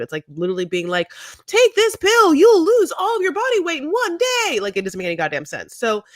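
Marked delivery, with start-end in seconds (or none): 1.5-4.6 infomercial shout. Retold or imitated. Mock infomercial claim.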